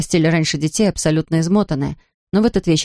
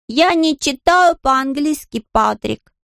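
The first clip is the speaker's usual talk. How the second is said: high, drawn-out mock nanny voice; child's exaggerated nanny impression; imitating a nanny; 8 semitones higher, slower than usual. A narrator's reading. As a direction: mimic a foreign nanny slowly and high. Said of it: "принялся подражать голосам нянь".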